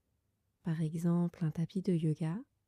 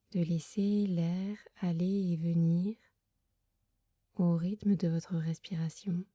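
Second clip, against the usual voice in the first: guided meditation. Acted. Leads a Yoga Nidra meditation. (voix douce et lente de méditation guidée)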